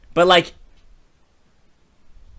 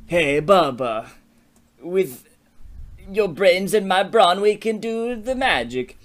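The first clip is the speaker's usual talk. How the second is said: slow, high put-on imp voice; scheming imp pitch; 5 semitones higher, slower than usual. Imp proposes a partnership to the boy, slow put-on voice. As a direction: draw it out like a little creature making an offer.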